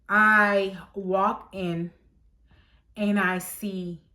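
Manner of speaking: drawn-out, slow build-up; slow suspense. Retold or imitated. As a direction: stretch each word to build suspense. Building suspense entering store.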